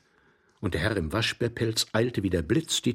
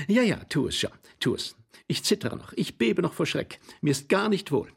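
high, fretful lady's voice; 8 semitones higher than usual. Acted. Lady tells husband she still trembles from fright, fretful. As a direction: fret in a high voice.

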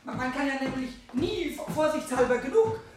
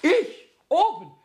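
startled denial; 7 semitones higher, 8 dB louder than usual. Acted. Pumuckl denies visiting cat.

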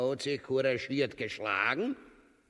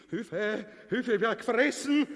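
lachend um Hilfe rufen; 9 semitones higher than usual. Acted. Gespielte Angst vor dem Bären.

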